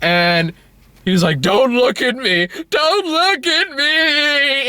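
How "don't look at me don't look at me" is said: high, whining mock tantrum; hissy fit. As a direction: escalate into a drawn-out high wail. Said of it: "he just has a hissy fit".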